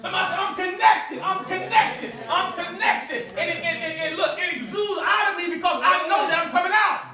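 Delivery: high, ringing sermon declarations; full-force preaching. Real, not acted. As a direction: proclaim it to rouse the congregation.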